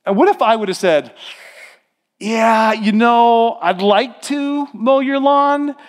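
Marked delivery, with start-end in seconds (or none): 2.2-5.7 high, slow, grudging yes; mock reluctance. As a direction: drag out a hammed-up reluctant agreement. Imagined reluctant reply to mowing.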